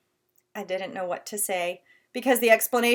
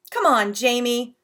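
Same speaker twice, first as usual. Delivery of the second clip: angry; 7 dB louder than usual. Acted. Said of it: "said Mom angrily".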